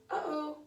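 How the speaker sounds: "Uh-oh" with a little click in the throat before the "oh"; glottal stop demo. Shown as a demonstration. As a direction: demonstrate a gentle glottal catch in "Uh-oh". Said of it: "and say".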